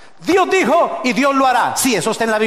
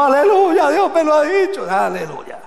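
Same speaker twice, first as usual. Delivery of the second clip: mock believer; 6 semitones higher than usual. Mocking emotional believer in church.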